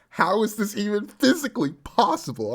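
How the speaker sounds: stunned by an impossible feat; astonished. Acted. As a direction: blurt it out in shock. Astonished reaction.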